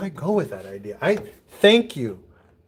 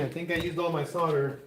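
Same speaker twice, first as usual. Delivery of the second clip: ordinary delivery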